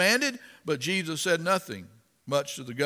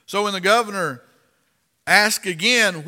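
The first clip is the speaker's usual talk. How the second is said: ordinary talk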